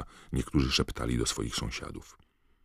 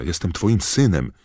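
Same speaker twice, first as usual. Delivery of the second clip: high, loud plea to a father; raised, imploring shout; desperate calling; 6 semitones higher, 8 dB louder than usual. A narrator's reading. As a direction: cry it out in full desperation. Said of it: "wołał do Croucha".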